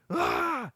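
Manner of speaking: high sudden angry yell; angry devil yell. Retold or imitated. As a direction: shout out in a burst of temper. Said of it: "And he's like".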